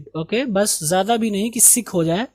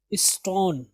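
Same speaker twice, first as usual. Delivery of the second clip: usual speaking voice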